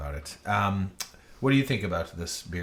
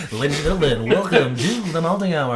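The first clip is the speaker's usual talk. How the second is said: loud, high, showy host announcement; game show host; 8 semitones higher, 9 dB louder than usual. Pretend. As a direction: welcome them like a TV quiz host. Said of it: "game show host voice".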